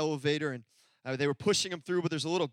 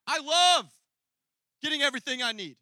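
gleeful; 6 semitones higher, 7 dB louder than usual. Real, not acted. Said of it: "Anybody love the second half".

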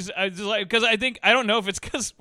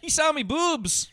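higher, slow, broad Canadian complaint; mock Canadian accent; 6 semitones higher, slower than usual. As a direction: drag it out in hammed-up Canadian. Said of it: "We're so Canadian".

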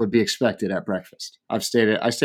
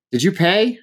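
high, rising mimicked query; prying question; 8 semitones higher than usual. Woman by the door to departing diner, prying. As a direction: pipe up nosily at a stranger. Stranger accosts him at door.

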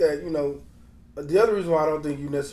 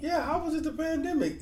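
high-pitched disbelief; incredulous question; 12 semitones higher, 3 dB quieter than usual. Real, not acted. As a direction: question the excuse in a much higher voice. Scoffs at an excuse.

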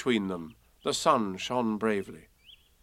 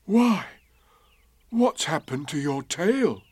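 higher-pitched startled bear; sudden puzzled discovery; surprised; 4 semitones higher than usual. A narrator's reading. Pooh noticing Eeyore's tail, surprised. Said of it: "he said in surprise".

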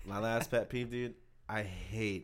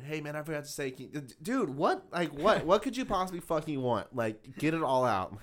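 exasperated; 5 semitones higher, 6 dB louder than usual. Retold or imitated. Dad calling four times.

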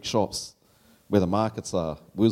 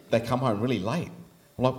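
normal talking voice

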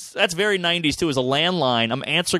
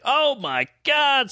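stunned awe; 10 semitones higher, much slower than usual. Retold or imitated. Teen stunned by woman's offer.

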